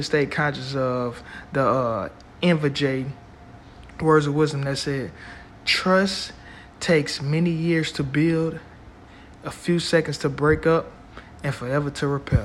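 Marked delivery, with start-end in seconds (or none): 5.6-12.4 slow quote, rising on trust; measured, weighty recitation; slow and deliberate. Shown as a demonstration. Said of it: "I'm gonna say that again".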